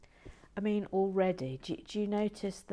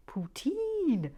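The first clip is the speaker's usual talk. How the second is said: poutine pitched very high and stretched on its last syllable, hammed-up Canadian; exaggerated Canadian accent; 10 semitones higher, 4 dB louder than usual. Shown as a demonstration. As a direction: overdo a Canadian "poutine", squealing the long "teen". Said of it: "Canadian accent".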